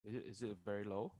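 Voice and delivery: faint voice, far from the mic; quiet question lost to the mic; barely audible. Real, not acted. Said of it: "Your audio seems to be low".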